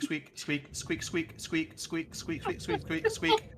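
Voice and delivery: soft squeaks rising very high at the end; squeaking squirrel. Acted. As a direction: squeak like a frantic little squirrel. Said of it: "All that comes out is just squeak".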